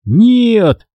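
high loud anguished no; mild tearful cry. A narrator's reading. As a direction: cry out in distress. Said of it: "заплакал Дэви".

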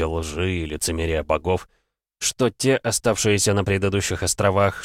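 plain reading voice

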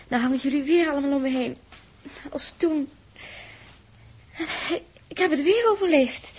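loud relieved outburst. Acted. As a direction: burst out, shaken but alive. Survivor relieved, crying out. (luide opgeluchte uitroep)

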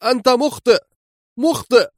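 very high, echoing cry; high-pitched, desperate-sounding yell; ringing call. A narrator's reading. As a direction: call out the accusation after someone leaving. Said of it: "وصوت جولييت يدوي في المكان".

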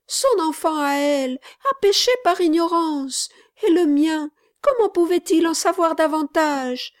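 a mother arguing through her tears; grieving mother protesting. A narrator's reading. Mother protests to gentleman about her son, high and tearful.

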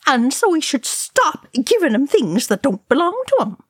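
high-pitched, indignant lecturing; retort. A narrator's reading. Oin arguing with Ori, high indignant retort. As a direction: argue back with pitch swooping right up. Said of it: "Oin retorted".

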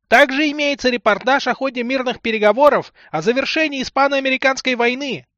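high, loud street newsboy cry; newsboy hawking. A narrator's reading. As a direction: shout the headlines like a paperboy. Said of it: "неприятно звонкий голос распространителя свежей прессы".

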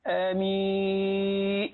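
آمين with the vowel dragged out very slowly; mock the over-stretched آمين; overlong ameen. Shown as a demonstration. Mocking worshippers who drag out آمين too long. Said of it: "إيش الكلام ده؟".

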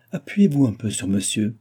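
ordinary delivery

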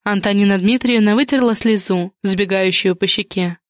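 ordinary delivery

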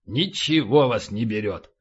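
low grumble; grumbling. A narrator's reading. Fighter to the survivors after the blast, low grumbling. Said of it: "проворчал Полубой".

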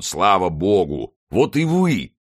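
slow, rising call of relief; relieved exclamation. A narrator's reading. Porthos welcomes his long-awaited friend, exclaiming. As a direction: hail your friend, ending high and loud. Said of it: "воскликнул он".

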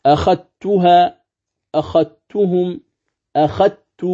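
أخذتها slowly with dhal merged into ta, akhattuha; Warsh recitation demo. Shown as a demonstration. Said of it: "نعم".

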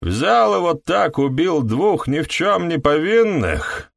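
high, slow, near-squealing protest; voice nearly squealing. Acted. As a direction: let the voice climb toward a squeal. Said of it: "Его голос почти сорвался на визг".

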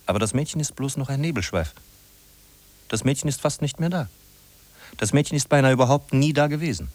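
normal talking voice

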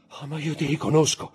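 high-pitched, strained realisation; dawning horrified recognition. Acted. Villefort to the man he buried, aghast. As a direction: let recognition rise towards a cry.

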